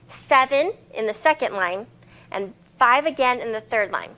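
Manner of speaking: ordinary delivery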